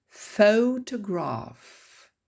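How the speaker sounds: "Photograph" stressed on PHO, drawn out slowly; stress on first syllable. Shown as a demonstration. Teacher showing the moving accent, "Photograph" stressed on first syllable. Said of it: "l'accent tonique bouge".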